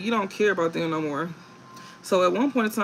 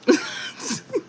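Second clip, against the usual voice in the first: high airy giggle. Real, not acted. Laughs at own joke.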